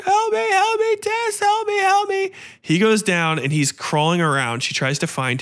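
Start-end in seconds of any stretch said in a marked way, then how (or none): 0.0-2.3 high, desperate cry for help; screamed plea for help. Retold or imitated. Man trapped in the dark crying to Tess, high desperate plea. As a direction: wail for Tess to save him from the dark. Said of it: "starts screaming a second later".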